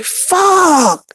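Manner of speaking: very high, stretched-out curse; dismayed cry. Retold or imitated. Hungry airman losing his meal, high mock wail. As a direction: let the word squeal out in mock dismay. Told to get up while still hungry.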